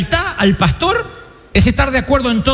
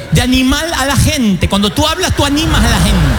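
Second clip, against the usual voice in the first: higher Puerto Rican impression; lilting imitation of a Puerto Rican; Puerto Rican accent; 4 semitones higher than usual. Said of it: "me dicen los ticos".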